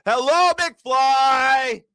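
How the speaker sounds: very high, slow mock shout of hello McFly. Host yelling at rambling Trump to wake up, mock shout. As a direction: drag it out, yelling to wake him up.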